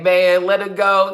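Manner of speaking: high, loud and slow plea; a passenger calling out to drop it; mock bystander plea. Passenger telling the bike man to drop it, loud slow plea. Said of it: "Some guy's like".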